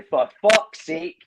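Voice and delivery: Scottish dad losing his rag; exasperated Scot. Retold or imitated. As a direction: drag out "fuck's" like a fed-up Scot. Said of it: "get the person to a T".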